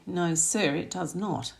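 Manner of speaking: ordinary delivery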